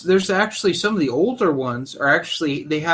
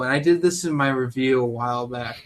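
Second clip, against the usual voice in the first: ordinary delivery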